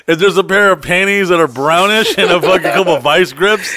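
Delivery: high loud excited rant. Real, not acted. Joking about the friend's trailer, loud excited rant.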